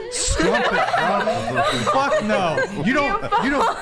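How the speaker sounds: laughing through the words; cracking up while ranting. Real, not acted. Laughing mid-rant.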